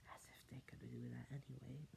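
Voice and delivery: soft whisper. Real, not acted. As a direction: whisper it, wry. Whispered lines.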